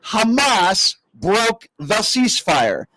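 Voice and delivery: spelling it out, very slowly, word by word. Real, not acted. Host to a caller he thinks is dim, spelled out very slowly. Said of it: "I'm trying to speak very slowly".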